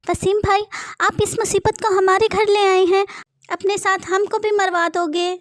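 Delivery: the wife shrieks at Waseem in fear; panicked wife. Acted. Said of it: "Farhan की बीवी बोली".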